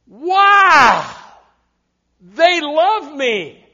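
gasp, then a loud, high drawn-out wow; hammed-up delight, stretching the words; mock thrilled. New card holder reading the letter, mock thrilled.

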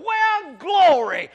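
loud, high cry of glory; joyful exclamation. Retold or imitated. Student finding a dime on the floor, loud joyful cry. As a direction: burst out with joy.

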